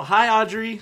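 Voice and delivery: loud, high calling out; shouted greeting. Acted. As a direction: call out to her cheerily.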